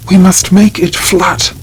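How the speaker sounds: a high, loud demand to flatten the grave; shouting. A narrator's reading. Crazed baron at the grave, high loud shout. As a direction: call it out hard and high, like an order. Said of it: "the Baron shouted".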